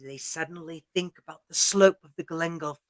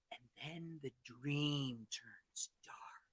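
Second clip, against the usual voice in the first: slow, near-whispered narration; hushed and foreboding storyteller; ominous hush; 6 semitones lower, 22 dB quieter, slower than usual. A narrator's reading. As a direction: let it sink to a dark, slow whisper. Dream turns ominous in story.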